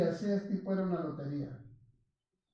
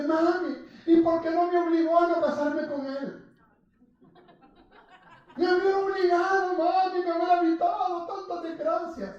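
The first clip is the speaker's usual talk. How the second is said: acted reproachful wail; 9 semitones higher, 4 dB louder than usual. Preacher voicing sister blaming mami for her marriage, acted wail. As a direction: wail it at mother in hammed-up anguish.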